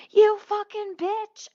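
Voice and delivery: high, loud, stretched-out mock scream; imitated scream. Driver insulting customer while leaving, imitated scream. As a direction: yell it slowly like a driver passing by. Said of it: "One person drove away screaming".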